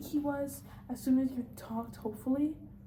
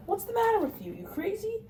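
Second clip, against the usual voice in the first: much higher, louder disbelief; incredulous outburst; 11 semitones higher, 5 dB louder than usual. Acted. Father scoffing at his wife's doubt, incredulous and loud. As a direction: scoff as if the idea is absurd.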